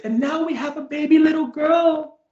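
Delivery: high, loud overjoyed announcement; acted elation. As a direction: imitate a new mother bursting with news. Retold mother's joyful news.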